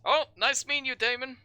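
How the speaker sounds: high, loud friendly doctor greeting; cheerful doctor. Acted. Doctor greeting Damon, high and cheerful. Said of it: "the doctor says".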